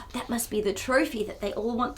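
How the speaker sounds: breathy secret whisper; hushed storyteller whisper. Acted. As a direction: let the children in on a secret. Whispering about the trophy.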